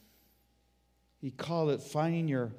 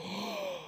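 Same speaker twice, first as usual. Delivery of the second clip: sharp high intake of breath; awed gasp; 9 dB quieter than usual. Acted. Angels gasp at first sight of the creation. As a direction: gasp in wonder.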